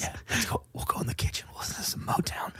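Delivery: ASMR whisper. Real, not acted.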